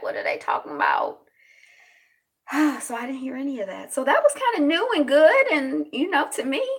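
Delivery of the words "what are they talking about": puzzled low aside. Retold or imitated. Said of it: "I'd be like".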